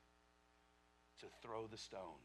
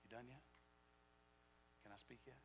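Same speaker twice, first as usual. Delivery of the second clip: soft, quiet, unbothered question; patient teacher waiting softly; calm patient Jesus; 14 dB quieter, faster than usual. Retold or imitated. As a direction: quietly ask if they're finished. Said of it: "He's just sitting there saying".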